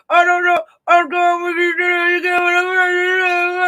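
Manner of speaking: lightly mock a mumbling, scared victim; hint of a tearful police statement; mock weepy statement. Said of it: "give us a statement to the police like this".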